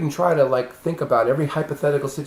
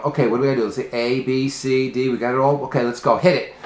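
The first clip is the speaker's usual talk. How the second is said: louder, mock-frantic rallying voice; freaked-out girl; 6 dB louder, faster than usual. Coach imitates client's old pre-performance self, freaked-out girl. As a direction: play her hectic, pushing herself on loudly. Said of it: "the freaked out girl going".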